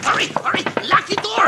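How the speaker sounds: frantic high-pitched urging; panicked. Acted. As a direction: shriek it in a panic. Fleeing into the cabin.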